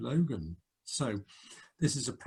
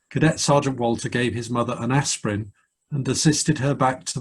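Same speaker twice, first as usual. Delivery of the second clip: louder, measured official read-out; formal citation reading; 9 dB louder than usual. Acted. Officer reading the first aid citation to the cadet, formal and loud. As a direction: read the citation like a ceremonial proclamation. Reads award citation aloud.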